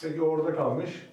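ordinary talk

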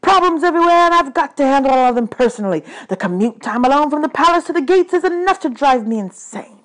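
high, loud tirade; exasperated ranting god. Acted. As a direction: rant like a god at wit's end.

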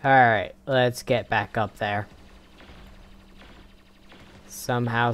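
ordinary talk

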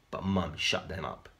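ordinary delivery, nothing marked

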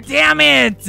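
loud, high angry yell; really mad. Acted. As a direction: burst out in frustration. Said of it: "really mad".

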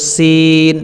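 সিন with hissed dental s and held ee; drawn-out letter name. Shown as a demonstration. Teacher showing how we will pronounce it, stretched সিন. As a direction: stretch the vowel of সিন evenly. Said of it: "একটু টেনে".

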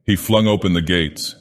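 ordinary delivery, nothing marked

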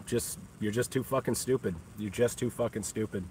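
dropped to a soft, contemptuous murmur; quiet sneer. Real, not acted. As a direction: sneer the insult almost under the breath. Taunting haters softly.

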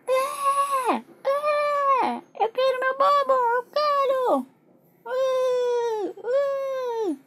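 very high, drawn-out wailing; crying baby. Pretend. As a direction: bawl and demand like a baby. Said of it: "ela só chora". (bebê chorando sem parar, bem agudo)

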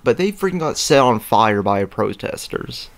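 ordinary talk, nothing marked

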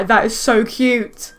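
ordinary talk, nothing marked

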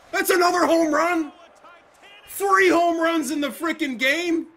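high, loud stunned outcry; slow, shocked, loud protest; disbelieving shout. Real, not acted. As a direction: yell it in real disbelief. Disbelief at home run.

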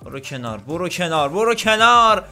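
rising chant into a loud announcement; building urgent warning. Real, not acted. Gamer clearing the way for Arnold, building urgency.

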